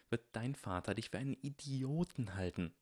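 soft, pressing hiss. A narrator's reading. Harry warns Draco about his father, hissing. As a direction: hiss the warning quietly. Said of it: "zischte Harry".

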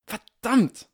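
loud, high, exasperated outburst; exasperated curse. A narrator's reading. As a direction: shout the curse in frustration.

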